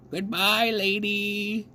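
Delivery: high, rising goodbye called from the dock; high called farewell; calling goodbye. A narrator's reading. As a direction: wave her off with your voice. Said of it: "she called".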